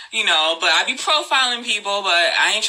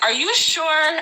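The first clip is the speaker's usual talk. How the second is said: lightly voiced, higher-pitched stranger; imitated doubting stranger; 7 semitones higher, slower than usual. Woman retells pushy stranger questioning her, lightly imitated doubt. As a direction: quote his doubt in a raised pitch. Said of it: "he kept saying, like".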